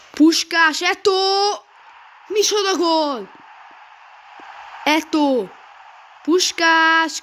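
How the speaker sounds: shouting names at a goal; high, loud goal call; excited hungarian sports commentary. Real, not acted. Gamer calls the winning goal.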